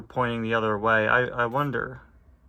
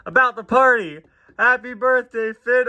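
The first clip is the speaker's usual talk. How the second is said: raised cheerful tow truck voice; Mater voice; 11 semitones higher, 10 dB louder than usual. Acted. Mater surprising Finn, a cheerful birthday greeting. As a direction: shout it like Mater at a party.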